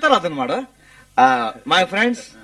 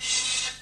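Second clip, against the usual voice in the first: soft "shh" to silence someone; a low warning shush; hushing shh; 12 dB quieter, much slower than usual. Acted. Husband hushing his wife in front of guests, soft shush.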